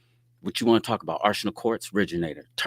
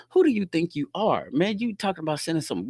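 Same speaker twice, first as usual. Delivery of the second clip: laughing mock challenge; 8 semitones higher than usual. Retelling how he laughed at a young man's threat, amused. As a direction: laugh him off. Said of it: "I'm laughing at him".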